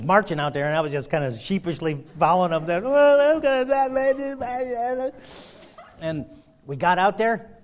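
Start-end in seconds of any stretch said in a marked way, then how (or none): 2.8-5.1 loud, higher hammed-up dread; mock dread. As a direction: fake doom while following him. Marching out, dread.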